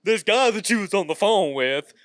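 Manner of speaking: loud, high-pitched comic grilling; mock complaint. Customer mimicking the host's rant at customer service, mock complaint. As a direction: fire off an exaggerated interrogation.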